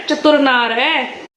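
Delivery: slow teasing. Real, not acted. Teasing son.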